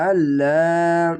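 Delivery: Allah said slowly, with the long আ held; one alif stretch. Shown as a demonstration. Teacher models the stretched Allah. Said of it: "এক আলিফ টেনে বলবেন".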